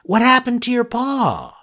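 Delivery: put-on high, concerned puppet boy; a curious boy worried for the cat; Pinocchio voice.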